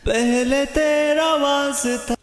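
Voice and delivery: usual speaking voice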